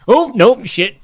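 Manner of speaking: high, loud startled yelp; startled panic. Acted. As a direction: blurt it in sudden panic. Character panics and rushes forward.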